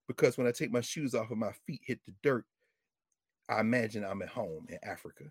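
lightly voiced old man, low and soft; quoted old man's reflection. Voicing an old man's quoted answer.

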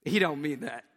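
dry knowing aside; God telling the angels, lightly voiced and dry; voicing God. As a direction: say it like God brushing off a silly prayer. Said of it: "that God went".

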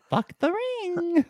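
slow, high put-on voice; mock quote. Quoting her on the show, slow and high mock voice. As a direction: drag it out in a silly high voice. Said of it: "she's like".

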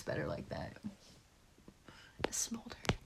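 an unvoiced whisper. Real, not acted. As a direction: breathe it out as a secret joke. Teasing remark about her hair.

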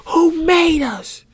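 high, loud, drawn-out cry; indignant wail; exasperated shout. Real, not acted. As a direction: shout it slowly at whoever is responsible. Ranting at faulty human design.

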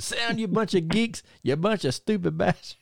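high-pitched mock crowd putdown; high retold ringside scolding; Bunkhouse Buck. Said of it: "and he said".

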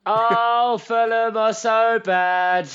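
high, slow, drawn-out; exaggerated despair. Real, not acted. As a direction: wail it, hamming up the misery. Mocking despair at the team.

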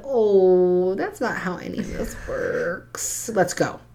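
sugary fake kindness; mock kind. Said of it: "I very kindly said".

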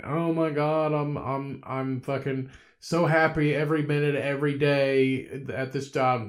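mock gushing about job bliss. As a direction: parody a too-happy employee. Mocking overly happy worker.

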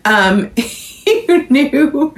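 slow, giggling through it; laughing. Real, not acted. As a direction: giggle while speaking. Laughing after burp.

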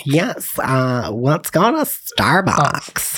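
put-on slow, drawn-out Valley Girl; Valley Girl accent. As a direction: stretch it like a mock Valley Girl. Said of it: "over a Valley Girl".

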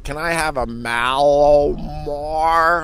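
"Mallomar" drawn out slowly and carefully. Retold or imitated. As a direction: say "Can I have a Mallomar" very slowly. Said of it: "and you're like".

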